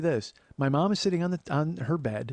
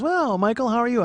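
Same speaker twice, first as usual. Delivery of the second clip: higher, louder imitation of a chatty aunt; aunt's voice; 9 semitones higher, 7 dB louder than usual. Aunt greeting her nephew Michael, mock-chirpy aunt voice. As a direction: play the aunt's effusive greeting.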